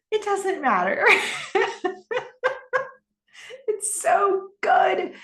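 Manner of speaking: high-pitched, laughing delight; delighted laughter. Real, not acted. Host relishing a brilliant scene, laughing with delight. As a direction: crack up with admiration as you finish.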